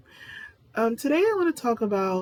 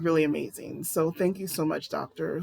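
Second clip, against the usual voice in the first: ordinary talk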